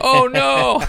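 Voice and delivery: loud, very high comic wail; mock horror. Reacting to a friend's text, mock horrified cry. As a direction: cry out in hammed-up dismay. Retelling horror at his mistake.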